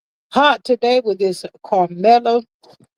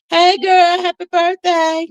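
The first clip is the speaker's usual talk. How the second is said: excited birthday greeting; 8 semitones higher than usual. Real, not acted. Host gushes happy birthday at her mod, excited and high. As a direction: squeal it out to your friend.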